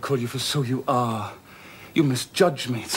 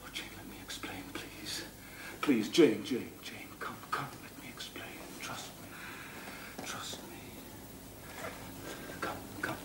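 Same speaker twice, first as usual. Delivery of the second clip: soft whispered begging; pleading whisper; 14 dB quieter than usual. Acted. Rochester begging Jane to hear him out, whisper. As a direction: beg her to stay, barely voiced.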